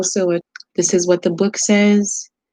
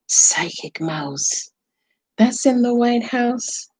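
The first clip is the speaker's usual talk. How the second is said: whispered slow mystical chant; Psychic Mouse whisper; 5 semitones higher than usual. Pretend. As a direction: hush it like a secret spell. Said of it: "you want me to talk like Psychic Mouse?".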